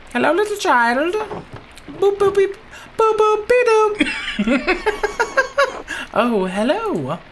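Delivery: high, loud cooing with beeps; baby talk. Pretend. Greeting the little child with high, giggly baby talk. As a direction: coo at the toddler with silly noises.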